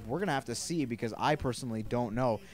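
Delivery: ordinary talk, nothing marked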